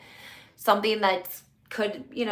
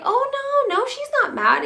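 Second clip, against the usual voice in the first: much higher, louder mock-eager reassurance; imitating reassurance; 6 dB louder than usual. Louis hurriedly reassuring Tamara, high mock gush. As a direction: squeak it out like a gushing denial.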